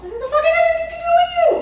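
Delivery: high, loud and fast, almost ranting; exasperated shout. Real, not acted. To a mother who blames herself, a fast exasperated shout. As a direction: blurt it out, fed up with the self-blame. Scolding the guilt-ridden mother.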